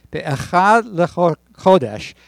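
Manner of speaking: Be'echad lechodesh read slowly, intoned; slow Hebrew reading. Acted. Pastor reads Leviticus in Hebrew to the congregation, slow intoned reading. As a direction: intone the Hebrew words with care.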